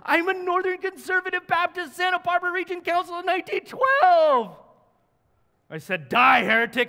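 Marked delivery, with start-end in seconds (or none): 0.0-4.6 much higher, louder triumphant yell; proud shout. Retold or imitated. Jumper shouts his exact denomination. 6.1-6.9 louder, higher sudden snarl; hammed-up outrage at a heretic; mock-furious before pushing him off; mock fury. Turning on the jumper.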